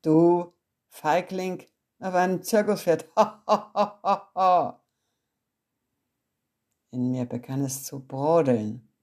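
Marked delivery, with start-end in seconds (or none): 0.0-4.8 mocking laughter. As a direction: laugh him down as a coward. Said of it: "Mein Vater lachte schallend". (Vater lacht den Sohn laut und spöttisch aus)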